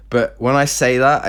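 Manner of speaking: ordinary delivery, nothing marked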